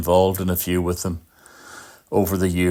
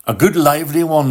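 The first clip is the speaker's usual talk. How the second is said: a higher, louder burst, quick; quoting a friend's lively phrase, higher and faster; lively quote; 9 semitones higher, 5 dB louder, faster than usual. Retold or imitated. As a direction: toss it off brightly as he would. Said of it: "as he said himself".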